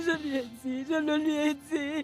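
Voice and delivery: tearful, high-pitched grieving sobs; crying. Acted. Daughter tells friends how she found her mother dead, crying. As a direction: speak through tears over her mother's death. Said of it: "arrête de pleurer".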